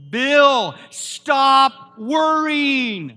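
very loud, high, slow drawn-out shout; screamed command. Retold or imitated. Preacher retelling how the Lord shouted at him, a slow booming cry. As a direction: bellow it like a voice booming inside you. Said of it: "like, screaming in my spirit".

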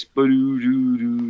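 slow sung bass line after a punchline; imitated bass riff. Comic imitating the joke's bass sting, slow and sung. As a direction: hum out a bass sting, ba-doo-doo-doo. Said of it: "And then there's the bass".

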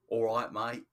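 hammed-up London accent; put-on Cockney. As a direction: greet like an American doing Cockney. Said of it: "put on a Cockney accent".

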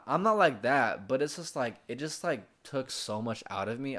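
ordinary talk

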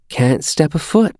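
put-on high small child voice; a five-year-old reciting the rules; little boy. A narrator's reading. Xander quoting the rules.

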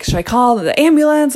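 high, loud retold panic; replaying a frantic call to her husband, mock panic; panicked. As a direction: blurt it out in a half-acted panic. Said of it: "automatic panic mode".

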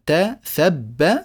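ت and ثب sounded out slowly, letter by letter; spelling out letters. Shown as a demonstration. Teacher spelling out ت، ثب one letter at a time. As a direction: count the teeth ت، ثب aloud, one by one. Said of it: "هذه ثلاثة أسنان".

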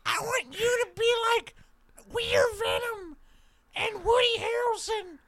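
thin squeal, stretched out word by word; Andy Serkis impression. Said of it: "quick impression of Andy Serkis".

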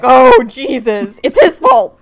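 loud panicked blame, slow start; panicked yell. Retold or imitated. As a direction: scream and point the finger. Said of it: "Asswipe is like".

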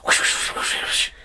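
voiceless quick sword swishes; Fruit Ninja whooshes. Shown as a demonstration. Host showing co-host the Fruit Ninja game, whooshing slashes. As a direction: slash the air with breathy swish noises. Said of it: "It's like a Fruit Ninja".

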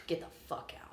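cold, breathy dismissal; harsh whispered order. Retold or imitated. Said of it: "I just looked at him".

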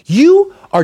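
high, loud gushing praise; butter them up in a high-pitched voice; over-the-top hype man; gushing flattery. Acted. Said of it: "giving out compliments".

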